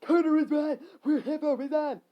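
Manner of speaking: high, loud agreement; shouting. Acted. Skeleton backing Cutter in the quarrel, shouting.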